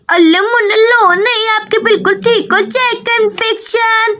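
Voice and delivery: put-on childish baby-talk lisp; lisping little girl. Daisy comforts the baby.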